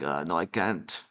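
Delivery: low, soft, badly faked German accent. German leader turning down a meeting, badly faked German accent. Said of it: "that was the worst, the worst accent of all time".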